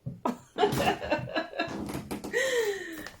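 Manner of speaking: high delighted laugh; laughing at the cat running away. Real, not acted. Cat runs off.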